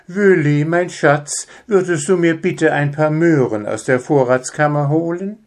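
mother calling sweetly. Acted. Mother calls husband from the kitchen, sweet. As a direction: call him sweetly from the kitchen.

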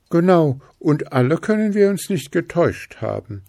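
Langöhrchen agreeing. A narrator's reading. Langöhrchen backs Stachelchen up, high and eager. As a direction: back him up eagerly. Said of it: "bekräftigte Langöhrchen".

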